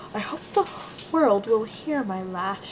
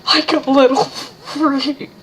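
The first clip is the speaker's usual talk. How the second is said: loud, higher mocking sneer; taunting character voice; 5 semitones higher, 11 dB louder than usual. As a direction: jeer at them in a put-on voice.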